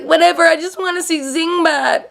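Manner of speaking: high, airy, dismissive imitation; hammed-up ditzy fan voice; mock Michelle. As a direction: toss it off like a daydreaming superfan. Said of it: "she's like".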